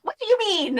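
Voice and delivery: high, indignant question; high affronted retort; indignant Celia. Acted. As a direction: demand an answer in a high, affronted voice.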